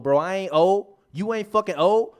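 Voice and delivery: ordinary talk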